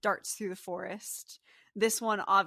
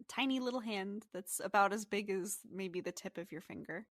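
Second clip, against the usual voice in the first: usual speaking voice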